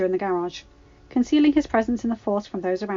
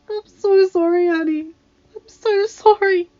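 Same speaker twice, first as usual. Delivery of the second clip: much higher, louder tearful voice; sobbing apology; 11 semitones higher, 8 dB louder, slower than usual. A narrator's reading. Padme apologises to her husband in his arms, sobbing. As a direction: sob out the apology. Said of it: "she sobbed as he held her".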